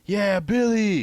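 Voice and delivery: high, loud cheer from the crowd; whooping girl at the keg. Acted. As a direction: shout it out at a party. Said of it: "I could hear the Becky girl cheering".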